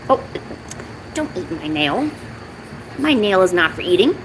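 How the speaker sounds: ordinary talk, nothing marked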